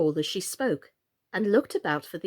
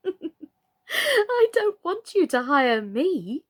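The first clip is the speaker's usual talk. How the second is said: much higher and slow, laughing through it; 10 semitones higher, 6 dB louder, much slower than usual. A narrator's reading. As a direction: giggle the refusal. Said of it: "Alice couldn't help laughing as she said".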